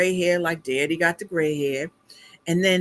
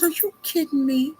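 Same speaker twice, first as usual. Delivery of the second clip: high-pitched incredulous question; retold disbelief; 8 semitones higher than usual. Daughter hearing Dad passes for a star, mock disbelief. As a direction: ask it high, as if you can't believe it.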